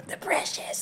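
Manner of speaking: hissy whispered creature voice; very soft, whispered film-creature impression; creature imitation. Imitating Gollum's catchphrase from the films.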